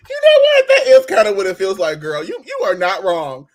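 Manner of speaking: loud exclaim. Retold or imitated. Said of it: "I was like".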